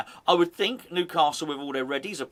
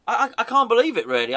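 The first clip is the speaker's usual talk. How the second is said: excited disbelief; 6 semitones higher, 5 dB louder, faster than usual. Real, not acted. Disbelief at free transfers.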